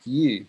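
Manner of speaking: "U" drawn out as a long "you" sound. Shown as a demonstration. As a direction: stretch "U" slowly, opening on a Y glide. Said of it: "pronounced with a long U".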